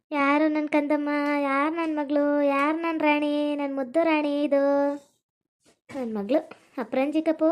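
slow, crooning endearments; tender lulling. Acted. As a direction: lull a child to sleep, slowly. Mother pats daughter to sleep. (ಜೋಗುಳದಂತೆ ನಿಧಾನ ಮುದ್ದು)

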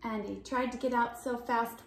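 ordinary delivery, nothing marked

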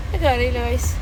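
worn out by the heat; exhausted. Real, not acted. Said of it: "എനിക്ക് വയ്യ".

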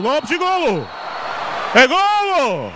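shouting the goal, rising high; full-throated goal call; fast dramatic football commentary. Real, not acted. Goal call in roller hockey commentary.

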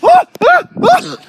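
sharp high screams from cold shock. Real, not acted. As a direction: scream as icy snow hits bare skin. Freezing snow on bare back.

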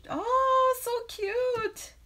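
high, delighted squeal; gushing. Real, not acted. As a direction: coo over something adorable. Reacting to a viewer's truck.

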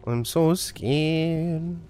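very slow, stretched-out fake dread; hammed-up drawn-out whine of fear; mock fear. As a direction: drag out each word in pretend fright. Feigning fear, mocking the game.

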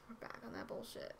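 hushed repeat. Real, not acted. Streamer back on stream, repeating it in a hush. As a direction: say it under your breath. Repeats the announcement under breath.

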